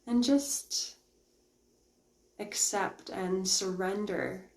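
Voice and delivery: slow meditation voice with long pauses; guided meditation. Real, not acted. Meditation host guiding live viewers to let go of the room, slow guided-meditation voice. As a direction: ease them into stillness, unhurried and quiet.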